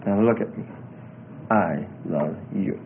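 quiet, measured fatherly affection; earnest, loving. Retold or imitated. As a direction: tell a daughter softly so it sinks in. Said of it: "get that I love them".

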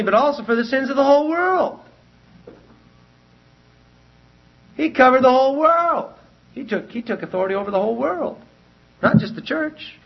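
fervent preaching, pitched high; rising preacher voice. Acted. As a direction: build it up like a preacher hitting the peak. Preaching at full force.

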